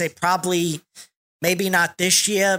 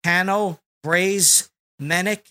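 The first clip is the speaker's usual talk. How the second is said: ordinary talk, nothing marked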